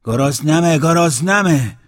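loud, high, slow drawn-out yell; a slow wailing hungry yell; shouting. Acted. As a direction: stretch the cry out slowly. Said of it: "داد زد".

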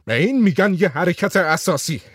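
half-hearted yell of triumph; voiced shout. A narrator's reading. Whitlam taunting the two men, mild mock shout. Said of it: "ویتلم با فریاد گفت".

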